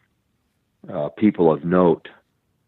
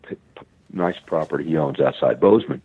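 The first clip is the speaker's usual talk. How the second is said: ordinary talk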